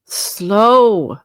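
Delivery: loud, drawn-out outraged echo; indignant cry. A narrator's reading. Ghost echoes Scrooge's word in outrage, loud. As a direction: fling the word back.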